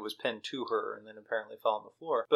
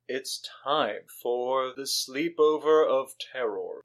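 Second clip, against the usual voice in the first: slow, drawn-out mock-horror announcement; hammed-up spooky build; ominous announcer; slower than usual. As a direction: announce it like a horror title, slowly.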